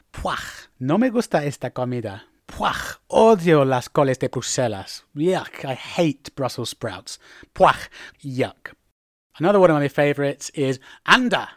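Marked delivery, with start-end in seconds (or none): 0.1-8.7 yuck, with a rasped ending on puaj; mock revulsion at nasty food; disgusted. Shown as a demonstration. Diner who hates Brussels sprouts, disgusted. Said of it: "is a bit like yuck".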